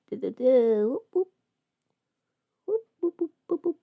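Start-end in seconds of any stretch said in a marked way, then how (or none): none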